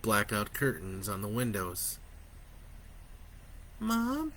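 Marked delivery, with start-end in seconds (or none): 3.8-4.3 put-on small, sleepy child's voice, higher; a little boy just waking, calling softly; tired little boy. A narrator's reading. Boy waking at night calling his mother, tired and small. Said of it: "he said in his tired little voice".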